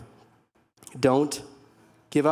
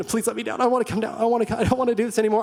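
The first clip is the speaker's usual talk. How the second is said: high, pleading wail, rushing at the end; tearful begging; 7 semitones higher, much faster than usual. Retold or imitated. Boy stuck on the climbing wall begging the belayer, high tearful plea. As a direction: beg to be let down like a crying kid. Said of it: "but I was in tears, begging".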